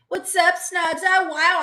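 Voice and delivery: high, loud flirty hello; flirty greeting. Real, not acted. Streamer flirts loudly with a fan, Snugs. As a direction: tease the fan with a squeal.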